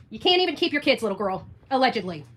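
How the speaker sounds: ordinary talk, nothing marked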